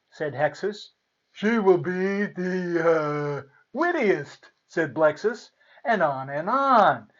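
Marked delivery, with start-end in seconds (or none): 1.3-4.5 slow, stalling fairy voice; hesitant blessing, then pop out the answer; fumbling fairy. A narrator's reading. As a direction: drag it out while hunting for a word.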